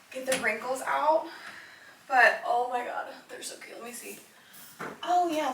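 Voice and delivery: ordinary talk, nothing marked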